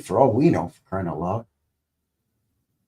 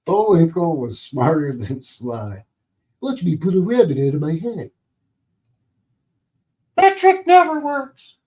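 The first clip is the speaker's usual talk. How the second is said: loud dopey cartoon moose; Bullwinkle voice; 7 semitones higher, 7 dB louder than usual. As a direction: boom it out like a dim cartoon moose. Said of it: "that Rocky and Bullwinkle cartoon".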